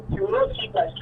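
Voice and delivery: ordinary talk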